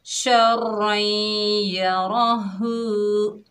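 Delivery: शर रई या रा हु stretched very slowly; slow melodic tajweed reading; slow recitation. Shown as a demonstration. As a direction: chant it slowly, holding हु. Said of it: "खींचकर पढ़ेंगे".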